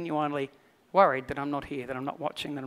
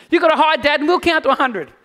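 put-on higher, louder excited child voice; eager kids; 10 semitones higher, 9 dB louder than usual. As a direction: insist like keen little boys. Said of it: "retold kids' demand".

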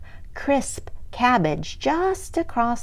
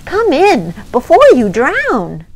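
calling out; 6 semitones higher, 10 dB louder than usual. Acted. Snail calling ants in rain.

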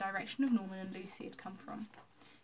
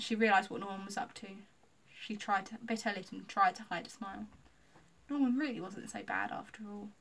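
ordinary delivery

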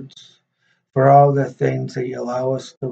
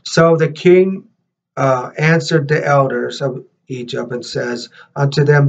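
louder, measured read-aloud voice; scripture reading; 2 semitones higher, 4 dB louder than usual. Real, not acted.

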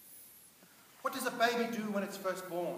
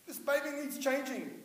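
normal talking voice

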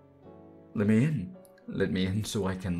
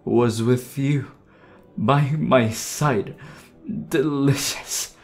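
slow, louder, drawn-out words; savouring relish; 4 semitones higher, 6 dB louder, slower than usual. Acted. Undead lover relishing darling's company, slow savouring. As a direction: savour each word slowly.

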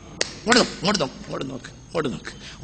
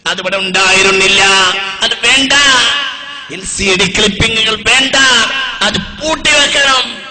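loud, high, impassioned exhortation; full-force preaching; 5 semitones higher, 11 dB louder than usual. Real, not acted. Preacher urging the crowd to shut off mocking clips, full-force preaching. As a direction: thunder the appeal out to the crowd.

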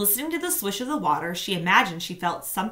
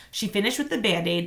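usual speaking voice

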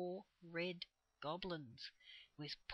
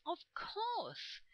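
excited cry; 12 semitones higher than usual. A narrator's reading. Children suddenly understand the trees' advice, crying out high. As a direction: cry out as it dawns on you. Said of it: "cried the children at once".

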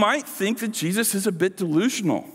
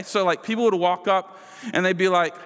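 ordinary delivery

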